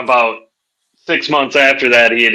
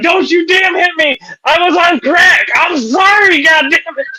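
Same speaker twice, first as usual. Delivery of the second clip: high-pitched comic shouting; panicked pleading; 14 semitones higher than usual. Retold or imitated. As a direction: yell a frantic apology before the blow lands. Victim begging not to be hit.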